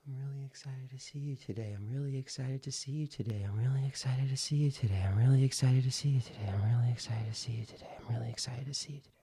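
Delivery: quick, fading to a whisper; fast repetition on exhale. Shown as a demonstration. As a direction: run it until the breath is gone. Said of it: "let me demonstrate for you".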